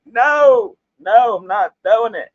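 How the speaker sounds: stubborn refusal. Retold or imitated. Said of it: "I'm like".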